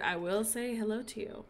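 ordinary talk

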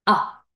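quick, cut-off "A" as ah; short A sound. Shown as a demonstration. Teacher contrasting with Ey, short "A". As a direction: say "A" as one brief open ah. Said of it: "¿Cuál era el sonido corto?".

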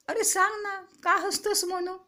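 high-pitched, impatient curiosity; eager curiosity. A narrator's reading. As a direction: pester a friend to tell. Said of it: "Sanju उत्सुकतेनं विचारत होता".

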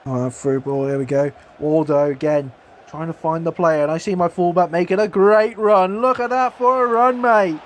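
ordinary talk, nothing marked

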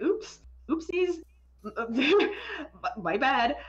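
high, slow, cutesy mock apology; mock oopsies. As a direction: play it babyish and sheepish. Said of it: "I was like".

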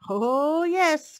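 loud, rising fox exclamation; gleeful cry. A narrator's reading. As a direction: cry it out in triumph. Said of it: "cried the fox".

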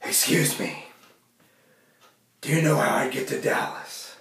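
low, soft, gruff Batman rasp. Retold or imitated. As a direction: Batman voice asking directions. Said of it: "talk like Batman".

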